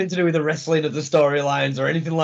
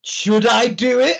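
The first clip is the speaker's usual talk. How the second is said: higher, louder, slow teasing question; teasing wrestler; 6 semitones higher, 5 dB louder, slower than usual. Retold or imitated. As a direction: dangle it like a panto villain. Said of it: "He's going".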